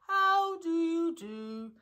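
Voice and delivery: high, very slow, mournful greeting; gloomy. A narrator's reading. Eeyore greeting Winnie the Pooh, gloomily. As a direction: drag each word out dolefully. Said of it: "in a gloomy manner to him".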